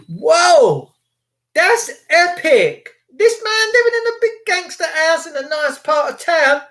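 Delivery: high, drawn-out mock awe; amazed reaction. Reacting to Rog giving up the BBC, high-pitched amazement. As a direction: marvel in a high, stretched voice.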